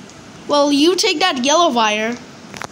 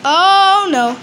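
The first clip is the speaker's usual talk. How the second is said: dismayed cry; 4 semitones higher, 5 dB louder than usual. Real, not acted. Caught by the piggy.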